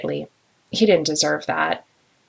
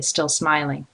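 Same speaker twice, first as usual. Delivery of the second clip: plain reading voice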